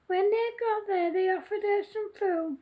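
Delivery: ordinary delivery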